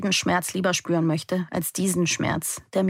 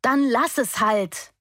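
yelled into the wind; 5 semitones higher, slower than usual. A narrator's reading. Running through the woods, yelling at her absent mother. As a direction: hurl it out slowly against the wind. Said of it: "brülle".

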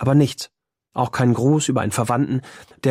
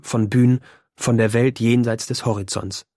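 plain reading voice

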